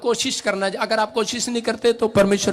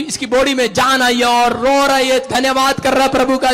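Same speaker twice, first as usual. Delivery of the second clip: loud, raised preacher's voice; rousing proclamation; 5 semitones higher, 5 dB louder than usual. Real, not acted. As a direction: build the crowd up to loud praise. Rousing congregation to praise. (जोश से ऊँची आवाज़ में घोषणा)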